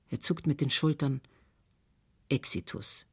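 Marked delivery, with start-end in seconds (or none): 2.3-3.1 low, hushed pronouncement; doctor softly pronouncing a death; quietly. A narrator's reading. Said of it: "sagt er leise". (leise, mit gesenkter Stimme)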